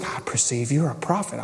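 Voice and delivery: soft, yielding admission; complete submission. Shown as a demonstration. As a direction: say it as if giving in completely. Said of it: "complete submission".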